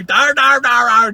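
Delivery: shark chomping noise. Retold or imitated.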